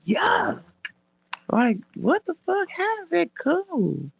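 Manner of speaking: high, slow disgusted whine. Retold or imitated. Retelling her reaction to the short, high disgusted. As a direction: recoil in high-pitched disgust. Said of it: "And I was like".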